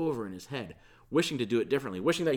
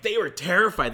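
higher and louder, gripped by fright; terrified; 7 semitones higher, 9 dB louder than usual. Shown as a demonstration. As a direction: say it like the women truly panicked. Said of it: "But no, it's like".

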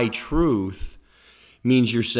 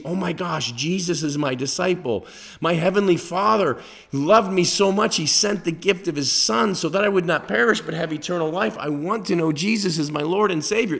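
new believer's joy; 7 semitones higher than usual. Pretend. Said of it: "you're reading the Bible and you see".

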